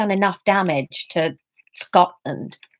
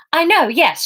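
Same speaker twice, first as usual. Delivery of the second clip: higher, curt and firm; blunt; 7 semitones higher, 4 dB louder than usual. A narrator's reading. Emma bluntly cutting off the receptionist. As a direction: cut him off flatly. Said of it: "she said bluntly".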